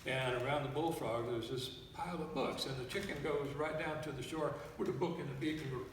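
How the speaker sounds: normal talking voice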